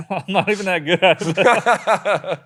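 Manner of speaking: loud, slow words through laughter; high, laughing self-mockery; real laughing. Real, not acted. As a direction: admit it while cracking up. Laughing at own admission.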